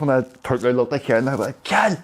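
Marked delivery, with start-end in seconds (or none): 1.6-2.0 sudden high call of a friend's name. Retold or imitated. Calling out to his mate on the bike, sudden. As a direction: shout his name to snap him out of it.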